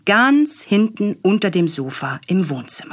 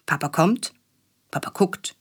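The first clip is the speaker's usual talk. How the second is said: usual speaking voice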